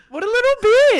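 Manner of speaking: high, loud mock scorn; hammed-up indignant outburst; mock outrage. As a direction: shriek it in joking disgust.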